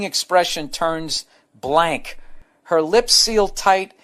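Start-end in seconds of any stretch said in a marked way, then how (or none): none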